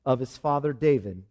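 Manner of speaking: low, measured reading of scripture. Acted. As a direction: read the promise solemnly, fading at the close. Reads scripture to congregation.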